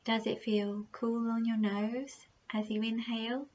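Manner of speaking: slow, soft meditation voice with unhurried, drawn-out phrases; guided meditation. Acted. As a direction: gently guide the listener through their breathing, leaving long pauses.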